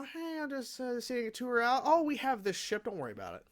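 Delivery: high-pitched, hurried mock excuse; mock nonchalance. Reviewer imitating two characters explaining away their ship, mock nonchalant. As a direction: brush it off in a high put-on voice, dropping at the end. Voicing Kilowog and Hal's breezy excuse.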